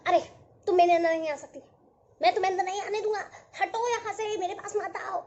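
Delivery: loud grumpy cloud refusing entry, fast; a cloud barking at the droplets to keep out; grumpy cloud shouting. Acted.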